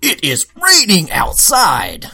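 mock a cheesy non-native voice actor; hammed-up robotic game dialogue; weird inflections. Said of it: "it was the weird inflections".

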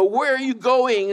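high, slow mock parent asking a toddler; gently mock the worried parent.

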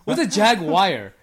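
slow, loud, mocking "jag-wire" for jaguar; mocking mispronunciation. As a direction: stretch jaguar into jag plus wire, making fun of it. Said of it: "a kind of wire".

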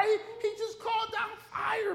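very high, amused disbelief; high-pitched amazed retort; incredulous squeal. Acted.

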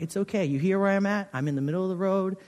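soft, steady calming voice, fading very quiet; older pastor guiding a frightened friend along the dark road, soft and steady. Retold or imitated. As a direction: guide a friend through the dark gently. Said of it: "my friend's voice was so calm".

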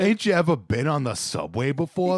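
mock a Brooklyn street kid voice; mock Brooklyn accent. Teasing a friend about a film's Brooklyn accent, hammed-up. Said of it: "the worst accent I've ever heard".

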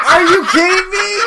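high, loud incredulous yell; laughing outrage. Real, not acted. Friend calls out Jamie's double standard, laughing outrage. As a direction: shout in disbelief through laughter.

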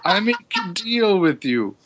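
high, pained pleading, clutching side; side stitch. Acted. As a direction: beg while holding a wound. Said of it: "clutching his side where the arrow struck".

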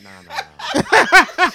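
laughing mock refusal. Girl turning the guy away at her door, laughing mock refusal. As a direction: drag out the nahs while cracking up.

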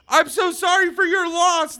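fanboy near tears consoling Chewbacca, a high mock sob; teary fanboy. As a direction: choke it out like a fan welling up, half joking. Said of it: "I'm sobbing".

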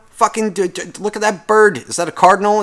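ordinary talk

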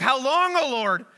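high, loud anguished cry to God; David crying out in his psalm, high and loud. Retold or imitated. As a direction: call out to the Lord in anguish. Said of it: "He's, like, crying out".

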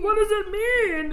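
very high, mock-clueless question; dumbass Harry. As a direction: squeak up high as the hammed-up dimwit. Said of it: "Harry's dumbass is like".

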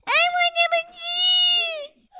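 put-on very high, slow whining toddler; baby refusing to use a big girl voice; drawn-out baby whine. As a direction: stretch the whine out like a stubborn baby.

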